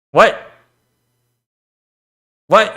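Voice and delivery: ordinary talk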